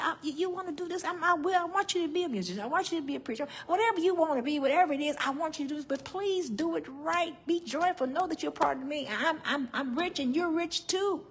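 high, loud, fast voice of God urging her on. Acted. God telling the preacher to be a musician, high and fast. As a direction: speak as God, lifted and eager. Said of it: "He said".

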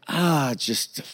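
soft, slow hesitant sigh; hesitate, sighing it out quietly; sheepish, dragged-out start; reluctant confession. Retold or imitated. Embarrassed kid confessing to stern dad.

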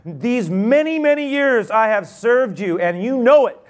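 high, loud, aggrieved protest; resentful older son protesting to his father; indignant son. Acted. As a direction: pour out years of resentment. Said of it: "older son says to his father".

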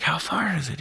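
high, eager hushed question. Retold or imitated. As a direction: play the keen hunting partner. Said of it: "and I'm like".

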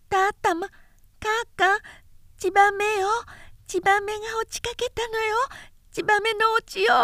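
put-on a small boy running in, tongue tripping over words; little son bursting in to tell his parents about a swallow, high and excited; lisping little child. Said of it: "回らない舌で叫びながら".

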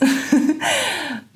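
a short high-pitched laugh. Real, not acted. Reminding herself views don't matter, high laugh.

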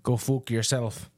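put-on Irish brogue; Irish accent. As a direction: answer back in a mock Irish accent. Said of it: "exhausted my Irish accent right there".

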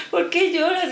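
high mock question; lightly voiced curious driver; driver asking. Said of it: "y ahora me dice".

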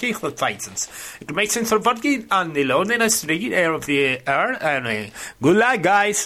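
made-up German gibberish; fake German. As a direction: babble in invented German. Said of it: "perfectamente el alemán inventado".

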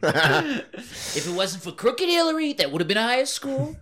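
mock Trump. Goofing on scores with friends, laughing mock Trump. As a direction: rant about Crooked Hillary as Trump. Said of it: "the old Trump accent".

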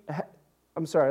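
ordinary talk, nothing marked

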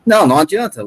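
ordinary talk, nothing marked

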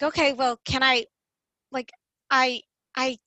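halting, stop-and-start protest; stuttering. Retold or imitated. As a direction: stumble over the first words, restarting after pauses. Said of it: "I just kept stuttering".

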